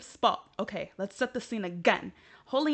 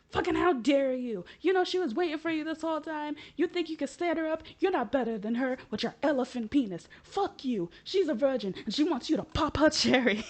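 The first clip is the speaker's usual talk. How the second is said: mock telling-off; 6 semitones higher, 5 dB louder than usual. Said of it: "and Holly's like".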